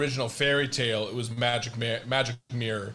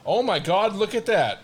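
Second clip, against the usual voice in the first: surprised; 6 semitones higher, 4 dB louder than usual. Real, not acted. Shocked at the silver leg.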